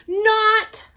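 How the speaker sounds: put-on loud, high childish scream. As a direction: scream it back at him. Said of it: "I screamed back".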